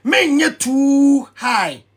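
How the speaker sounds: menyɛ too high stretched very slowly, each word leaned on; drawn-out stress. Shown as a demonstration. Drawn-out advice, high vs low.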